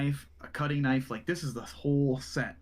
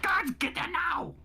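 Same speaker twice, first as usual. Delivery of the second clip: urgent order; slower than usual. Acted. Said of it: "and he's like".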